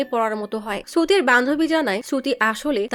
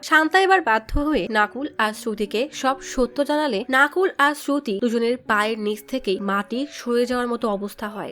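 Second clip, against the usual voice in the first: ordinary delivery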